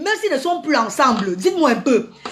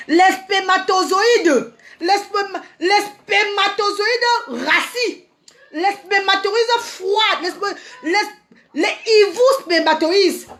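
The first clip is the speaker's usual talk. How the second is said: mock insult; 2 semitones higher, slower than usual. Mock insults, stretched slowly.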